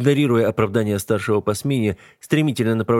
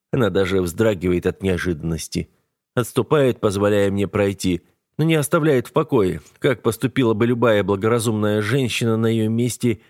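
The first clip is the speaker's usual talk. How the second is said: ordinary delivery, nothing marked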